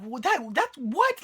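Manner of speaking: incredulous rising what; puzzled, voice climbing high; baffled. Real, not acted. Baffled reaction to bad acting.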